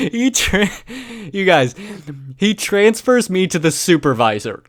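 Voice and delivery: giggling while retelling the transfer; laughing through words. Real, not acted.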